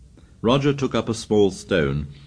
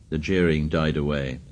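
ordinary delivery

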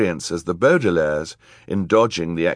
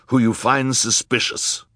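higher-pitched villain giving orders; put-on villain voice, raised in pitch; villainous man; 7 semitones higher, 4 dB louder than usual. A narrator's reading. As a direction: command it like a villain. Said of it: "said the villainous man".

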